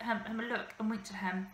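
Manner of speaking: plain reading voice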